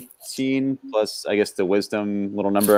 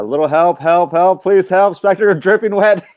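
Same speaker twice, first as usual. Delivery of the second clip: building, higher and louder cries for help; yelling for help; 8 semitones higher, 7 dB louder than usual. Acted. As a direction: call out, rising to a panicked yell. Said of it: "so I will yell".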